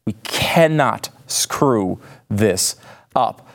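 very slow, a pause between each word; emphatic warning. Real, not acted. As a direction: spell out "cannot screw this up" word by word.

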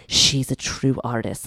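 low, slow mock reverence; imagined awed teacher. Teacher imagined praising a student's artistry, mock-solemn and low. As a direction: solemnly pronounce it, low and drawn out. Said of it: "I thought my teacher was like".

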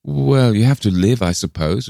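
jolly her along, lifting the first words; cheerful. A narrator's reading. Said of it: "replied Martha Pym cheerfully".

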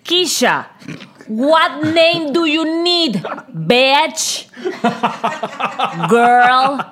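high, slow, sassy English; sassy attitude. Pretend. Talking back to the insurance agent, slow and sassy. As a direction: draw it out with sass and swagger. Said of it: "una actitud de".

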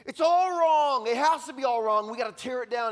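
high, loud outraged protest; angry camp. Acted. Preacher voices the angry camp, angry shouting. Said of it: "this camp that's just angry".

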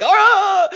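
high, drawn-out mock scream; panicked yell. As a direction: yell as if cornered by pressure. Retold panic under wife's pressure.

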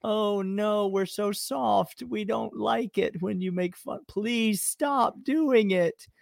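high, whiny mock sobbing; fake crying. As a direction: whimper like a teasing crybaby. Mocking hurt Alabama fans.

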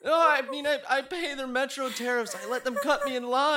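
high, loud, agitated pleading; panicked protest. Acted. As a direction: protest your innocence in a panic. Panicked protest about the babushkas.

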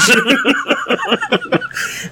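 a real laugh that climbs high; high squealing laugh. Real, not acted. Laughing at duplicates remark.

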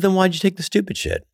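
high-pitched indignant demand, not shouted; retold anger. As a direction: imitate an angry man's accusing question. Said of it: "was so mad".

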